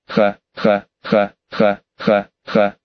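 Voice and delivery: low, slow maniacal villain laugh; insane laughter. Acted. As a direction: cackle like a mad demon king. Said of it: "безумно рассмеялся бесподобный король демонов".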